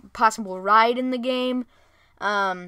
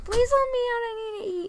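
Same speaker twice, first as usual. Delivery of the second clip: very high, whiny kitty voice; pleading cat; 13 semitones higher, faster than usual. Acted. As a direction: beg in a tiny high-pitched pet voice. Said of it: "but my cat's like".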